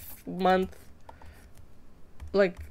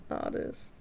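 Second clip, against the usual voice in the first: whispered echo; 10 dB quieter than usual. Shown as a demonstration. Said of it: "Stu's a little artist".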